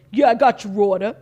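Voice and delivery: loud Italian-American mobster phone voice. Retold or imitated. Restaurant guy on the phone, loud Italian mobster accent. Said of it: "big Italian, like, mobster".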